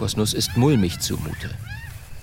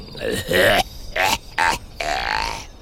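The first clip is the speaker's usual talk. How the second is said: low villainous chuckle; evil laugh; 8 semitones lower than usual. Acted. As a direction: laugh like a gloating monster.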